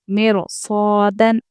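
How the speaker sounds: slow मेरो सौदन with a full, heavy र; heavy ra recitation. Shown as a demonstration. Teacher reciting the example for students, slow. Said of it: "रॉ को पुर पढ़ेंगे".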